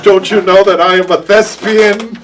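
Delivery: loud, laughing mock grandeur. Real, not acted. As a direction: boom it out, cracking up. Mock grand claim while laughing.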